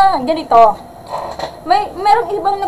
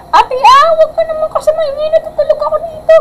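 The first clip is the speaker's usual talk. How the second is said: put-on much higher voice for the young niece; niece's complaint. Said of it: "Sabi ng pamangkin ko". (mataas na boses ng pamangkin na nagrereklamo)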